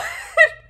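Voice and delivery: loud, high burst of real laughter; sudden high cackle of disbelief. Real, not acted.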